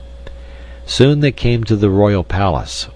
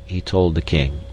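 ordinary delivery, nothing marked

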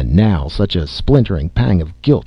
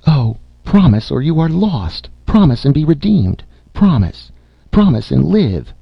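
high, insistent imploring, acted; urgent pleading; 7 semitones higher than usual. A narrator's reading. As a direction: implore with a high cry on each demand. Aunt's last frantic plea for promise.

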